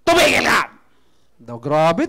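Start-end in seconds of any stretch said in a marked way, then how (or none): 0.1-0.7 mock angry snap. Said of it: "Tumaas ang boses".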